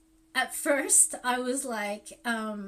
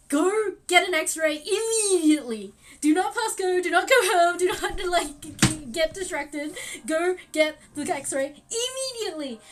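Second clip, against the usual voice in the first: urgent doctor; 7 semitones higher, 8 dB louder than usual. Retold or imitated. Doctor's urgent orders retold.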